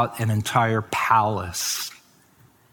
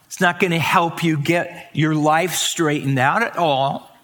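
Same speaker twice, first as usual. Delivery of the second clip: ordinary talk, nothing marked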